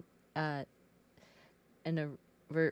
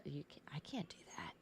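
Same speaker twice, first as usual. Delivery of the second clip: whispered; 11 dB quieter, faster than usual. Retold or imitated. Kid's forbidden thought.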